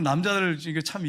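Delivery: ordinary talk, nothing marked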